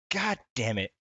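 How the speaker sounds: ordinary talk, nothing marked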